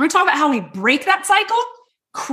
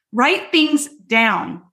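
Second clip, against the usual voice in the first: Write things down, slowly and lower; slow, lower repeat; 3 semitones lower, much slower than usual. Shown as a demonstration. Coach repeating the tip, slow and lower. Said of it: "repeats the tip slowly".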